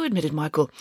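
ordinary delivery

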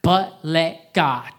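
"but let God" slow and loud, each word pressed; 把"but let God"放慢加重，逐字强调; stress on but let God. Shown as a demonstration. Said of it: "let's put an emphasis on the words".

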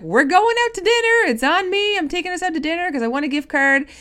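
high, loud, beaming invitation; excited announcement. Retold or imitated. Inviting friends to a dinner she won, loud high excitement. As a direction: announce the treat with big energy. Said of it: "I was like".

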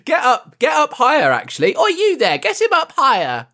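mock commander. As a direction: shout orders like a pompous officer. Mock orders to raise the cadet.

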